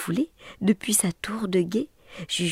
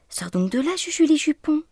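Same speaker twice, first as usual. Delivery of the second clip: big sister begging; 9 semitones higher, 4 dB louder than usual. A narrator's reading. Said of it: "suppliait sa grande sœur".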